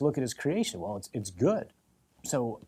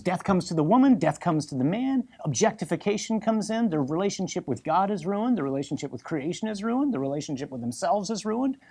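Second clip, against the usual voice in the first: usual speaking voice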